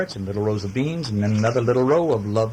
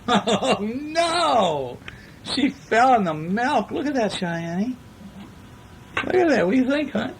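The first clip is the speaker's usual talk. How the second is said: much higher, laughing delight; 11 semitones higher, slower than usual. Real, not acted. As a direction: parent laughing with her son over the milk. Parent laughs at puppy mishap.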